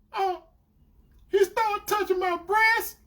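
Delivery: high, slow reluctant mimicry; confessing girlfriend. Girlfriend confessing to being touched, imitated reluctantly. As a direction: draw the admission out in a high voice.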